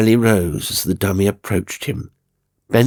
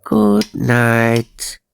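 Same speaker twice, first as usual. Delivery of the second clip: slow, eerie puppet voice; a wooden doll bowing as it speaks; dummy voice; 3 semitones higher, 5 dB louder than usual. A narrator's reading. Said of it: "bowed the thing".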